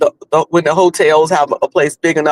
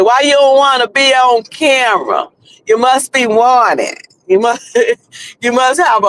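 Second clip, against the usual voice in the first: put-on North Carolina Southern accent; 5 semitones higher than usual. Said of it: "William with that, uh, with that, uh, accent".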